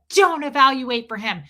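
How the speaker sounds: loud mock reprimand; retold yelling. Lady scolding the baby's mother, imitated yelling. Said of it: "she starts screaming at me".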